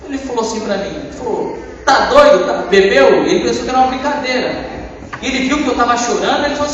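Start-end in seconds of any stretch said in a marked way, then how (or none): 1.8-3.2 a louder incredulous outburst; startled partner. Retold or imitated. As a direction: shout your disbelief at him. Partner reacting in disbelief.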